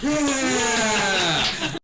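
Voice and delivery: long, high acted cheer; crowd cheer. Crowd cheering after the older woman nods, whooping. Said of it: "and everybody's like".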